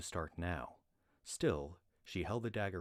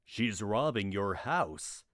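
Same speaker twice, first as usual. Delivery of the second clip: slow, louder disbelief; shocked; 3 semitones higher, 8 dB louder, slower than usual. Acted. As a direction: state it stunned. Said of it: "Seifraith looked shocked".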